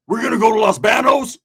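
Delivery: high, loud, "Banos" with flat A as BAN-ohs. Retold or imitated.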